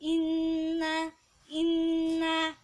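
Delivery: "Inna" with a long hummed double n; ditekan dan didengungkan. Shown as a demonstration. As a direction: hum through the nn of "Inna" on one note. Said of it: "harus ditekan dan didengungkan". ("Inna" dengan nun didengungkan lama)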